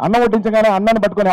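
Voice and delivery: normal talking voice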